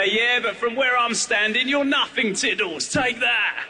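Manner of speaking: put-on high, cocky child's taunt; a kid showing off to the cat.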